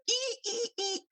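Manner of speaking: high squeaky rat squeals; rat squeak. Retold or imitated.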